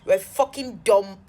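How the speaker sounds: loud, emphatic insults; reenacted angry name-calling; retold cussing out. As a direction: cuss him out, punching each insult loudly. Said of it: "I cussed him".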